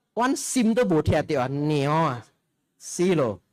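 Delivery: loud, nagging Hokkien mother-in-law; scolding Hokkien mother-in-law. Pretend. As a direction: scold like a fierce Medan in-law. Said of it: "apa mertua bahasa Hokkien?". (mertua galak mengomel dalam bahasa Hokkien)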